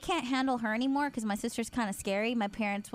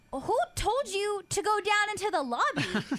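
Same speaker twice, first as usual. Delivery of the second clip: much higher, slower, indignant retold yell; retold angry yelling; 8 semitones higher, slower than usual. Big sister confronting younger sister in the car, high indignant yell. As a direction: hurl the question back at her, offended. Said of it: "Is that how mad you were?".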